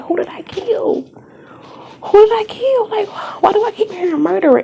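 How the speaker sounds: high, hushed, frightened questioning; retold bewildered panic. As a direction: act out alarmed confusion in a high voice. Retells her bewildered panic at voices.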